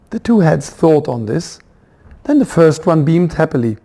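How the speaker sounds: ordinary delivery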